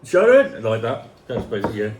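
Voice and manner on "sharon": put-on called out in a Birmingham accent, raised a little. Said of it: "He asked for a [name]".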